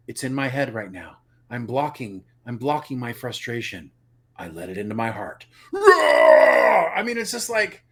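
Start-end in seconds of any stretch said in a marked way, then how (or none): 0.1-5.5 low, softer mock inner struggle; imitated method acting. Mocking how he should act out the struggle, restrained. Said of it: "It's called acting". 5.7-6.9 loud, drawn-out roar; mock rage roar. Mocking his transformation into anger, exaggerated roar.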